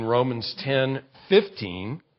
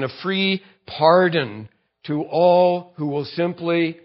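ordinary delivery

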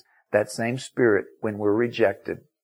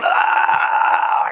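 high, loud strangled yowl; imitated dog cry; 5 dB louder than usual. Little dog dragged off on leash, choking cry. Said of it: "he was sitting there going".

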